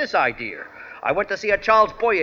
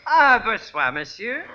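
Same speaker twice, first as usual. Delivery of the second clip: put-on phony French accent; 3 semitones higher, slower than usual. Phony Frenchman greets his host.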